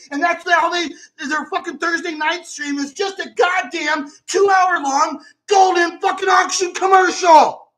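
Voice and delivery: furious shout. Real, not acted. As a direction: yell it, boiling over at the frauds. Furious rant at rival shows.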